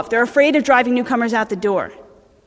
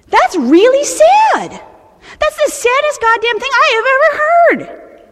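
exaggerated dismay; 12 semitones higher, 4 dB louder than usual. Real, not acted. Dismayed rant about few sponsors.

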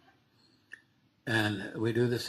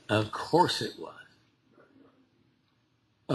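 normal talking voice

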